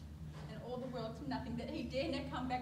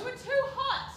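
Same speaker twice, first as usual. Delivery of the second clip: high loud rebuke; 11 semitones higher, 11 dB louder than usual. Acted. Nurse rebukes father.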